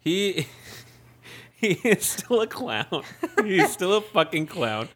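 laughing through the words; chuckling, slow and amused. Real, not acted. Laughing at Trump.

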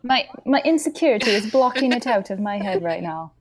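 playful put-on British accent. Dodging a question she forgot, in a silly British accent. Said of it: "hearing your voice in a British accent".

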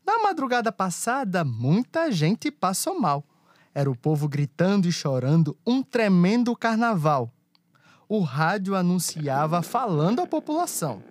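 rhythmic rhyming verse storytelling; cordel recitation. A narrator's reading. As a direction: recite like a folk poet.

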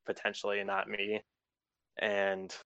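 normal talking voice

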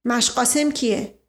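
ordinary delivery

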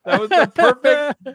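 loud, high, laughing exclamation; real laughter in the voice; delighted laughing. Real, not acted. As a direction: gush it while cracking up. Still cracking up at mishap.

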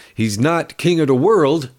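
usual speaking voice

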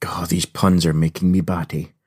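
ordinary talk

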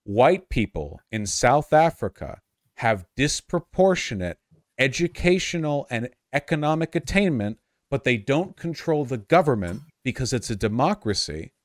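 slow, deliberate, every word spelled out; over enunciating. Shown as a demonstration. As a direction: explain it slowly as if to a child. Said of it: "maybe I'll say it slower".

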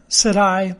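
ordinary delivery, nothing marked

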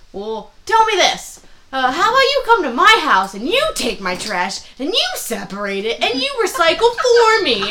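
hammed-up loud barfly; mock George. George impression continued.

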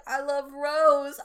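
a touch of mock crying; tearful confession. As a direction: say it like it breaks your heart. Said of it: "And he was like".

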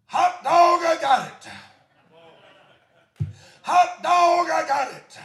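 loud, high exclamation of joy; hot dog, hollered with glee; joyful shout. Retold or imitated. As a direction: shout it out like a man just saved. Convert shouting for joy at altar.

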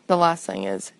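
usual speaking voice

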